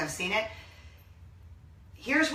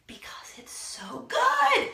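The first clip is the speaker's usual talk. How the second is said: gushing whisper; much slower than usual. Real, not acted. Gushing about the movie.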